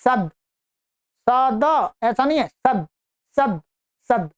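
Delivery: सद said with the final द cut short, no vowel after it; half consonant. Shown as a demonstration. Teacher drilling the halant द in सद, repeated. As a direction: clip सद with a bare द ending. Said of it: "हलंत है, अर्थात आधा".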